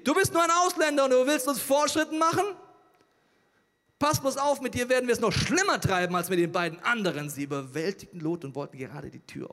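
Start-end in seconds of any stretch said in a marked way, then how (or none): 0.0-7.3 loud, high angry threat; mob screaming threats. A narrator's reading. Men of Sodom threaten the foreigner Lot, screaming. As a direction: shout him down like a hostile mob. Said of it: "schrien sie".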